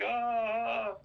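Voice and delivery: high, sustained mock scream; imitated scream. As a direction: act out a victim's scream without full volume. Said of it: "screaming, she's like".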